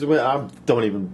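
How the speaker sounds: usual speaking voice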